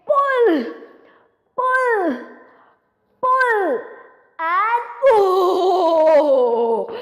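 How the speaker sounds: high loud heave on pull; effortful shouting. Acted. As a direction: strain hard on every pull. All straining at turnip.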